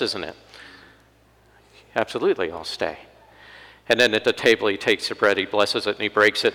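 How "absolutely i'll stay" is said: softly agreeing. Retold or imitated. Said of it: "what Jesus would do".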